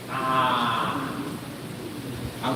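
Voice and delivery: ordinary delivery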